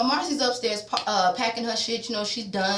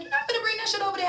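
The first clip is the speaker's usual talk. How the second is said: much higher, fast mother's scolding; shrill mock mama; 13 semitones higher, much faster than usual. Vlogger mocking Randall's mother forbidding him, high and fast. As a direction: squawk it like his mother. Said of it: "She's like".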